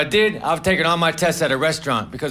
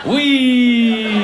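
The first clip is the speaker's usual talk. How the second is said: long, higher mock-gleeful whee; having fun; 4 semitones higher, 3 dB louder than usual. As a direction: stretch it out like riding down a slide. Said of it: "when you're having fun".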